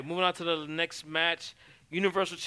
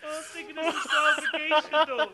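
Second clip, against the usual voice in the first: after laughing hard; 8 semitones higher than usual. Real, not acted. Still laughing.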